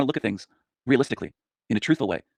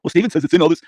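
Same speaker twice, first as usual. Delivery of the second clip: higher, louder mock of a gullible fan; lightly voiced gullible fan; mock eager follower; 7 semitones higher, 8 dB louder than usual. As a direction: parrot it like a naive viewer. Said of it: "This is where human minds go".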